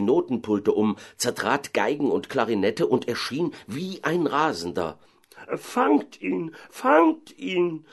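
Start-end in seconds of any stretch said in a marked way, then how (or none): 5.6-7.8 high, alarmed mayor's cry; beside himself. Acted. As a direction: call out frantically, pitched way up. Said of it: "rief der Bürgermeister ganz außer sich". (außer sich rufen)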